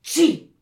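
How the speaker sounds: loud acted sneeze with big intake. A narrator's reading. Nikolaus out in the cold, sneezing loudly. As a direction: gasp in, then explode into "Tschi". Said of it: "niest der Nikolaus".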